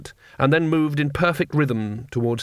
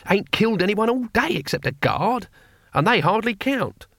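lightly voiced, higher-pitched old grumbler; grumbling old warrior; 8 semitones higher than usual. A narrator's reading. Cohen complaining he has barely killed anyone, lightly voiced grumble. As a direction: grumble a little higher than your own voice.

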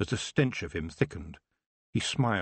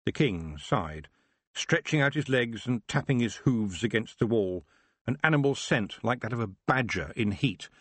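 ordinary delivery, nothing marked

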